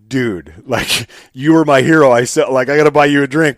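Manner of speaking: retold fan gush, loud and high with a laugh; gushing like a fan meeting his hero; excited gushing. Fan meeting his hero at a convention, gushing loudly.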